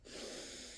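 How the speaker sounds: soft real exhale to recover; recovering breath after exertion; catching breath. Real, not acted. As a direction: let out a quiet, winded breath. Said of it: "let me just breathe".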